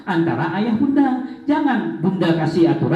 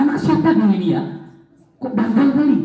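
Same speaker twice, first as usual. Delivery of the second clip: mock angry parent; 5 semitones higher, faster than usual. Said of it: "Sampai marah".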